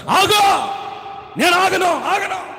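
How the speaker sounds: high frantic shouts; shouting. Acted. Husband and fleeing wife shouting at each other. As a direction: yell it while running off.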